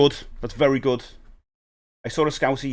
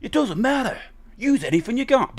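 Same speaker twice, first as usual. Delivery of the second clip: mock advice voice; 11 semitones higher than usual. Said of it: "people go".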